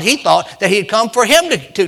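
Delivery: ordinary talk, nothing marked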